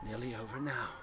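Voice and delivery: dying voice, barely there; faint voice. A narrator's reading. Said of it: "Then the faint voice of Warren".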